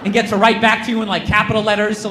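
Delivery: normal talking voice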